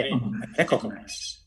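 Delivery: রিকগনাইজ said slowly with a short "re" at the start, not "rio"; slow, careful রিকগনাইজ beginning with "re"; correct pronunciation. Shown as a demonstration. Teacher correcting a student, slowly modelling রিকগনাইজ. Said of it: "রিও না, রে".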